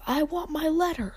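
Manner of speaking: high shouted demand. A narrator's reading. Boy shouting at his uncle for his letter. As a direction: cry out for what's yours. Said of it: "he shouted".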